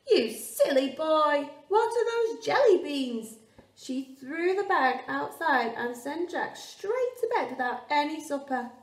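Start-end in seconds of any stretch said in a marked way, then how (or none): 0.1-3.4 furious mum. Acted. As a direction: scold the silly boy at full volume. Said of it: "his mum was furious".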